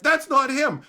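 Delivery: high, loud mock contradiction; loud denial. Reddit crowd refusing to believe it's him, loud denial. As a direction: shout it down as the crowd.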